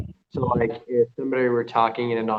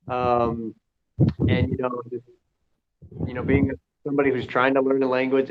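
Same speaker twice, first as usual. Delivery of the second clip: ordinary talk, nothing marked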